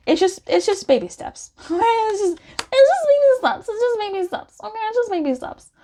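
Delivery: lilting high voice, coaxing; gentle high sing-song about baby steps. Acted. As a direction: coo it like encouraging a toddler. Coaxing the client along.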